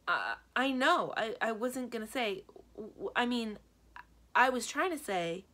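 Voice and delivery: flustered, high stammer. A narrator's reading. Narrator backpedaling from Kenny, stammering high. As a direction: trip over the words in a panic. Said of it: "I jump back and stammer".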